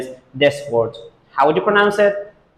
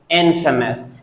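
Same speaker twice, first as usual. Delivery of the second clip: INFAMOUS said IN-fuh-mus; first-syllable stress. Shown as a demonstration. Teacher shows where stress falls, first-syllable stress. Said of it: "the stress is on the first syllable".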